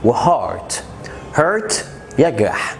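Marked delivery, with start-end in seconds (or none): 1.4-1.7 hurt pitched up as a clear teaching word; hurt with an er sound. Shown as a demonstration. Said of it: "عن كلمة hurt".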